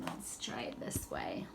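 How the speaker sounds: slow, breathy trial whisper. Shown as a demonstration. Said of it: "Nope, don't like that".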